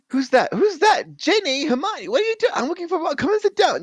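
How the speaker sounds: high, loud, confused calling; high girl voice. Acted. Ginny searching the dark for Ron, high and calling. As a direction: call out blindly in the dark.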